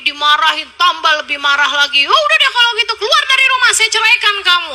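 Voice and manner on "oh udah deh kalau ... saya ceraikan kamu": mock angry husband. Husband yells at the wife to leave, hammed-up anger. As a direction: play the furious husband throwing her out.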